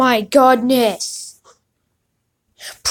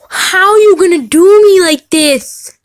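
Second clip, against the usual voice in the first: loud, slow, higher mock whine; mock complaint; 6 semitones higher, 6 dB louder than usual. Real, not acted. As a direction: pretend to be wronged by luck. Mock complaint at lucky pull.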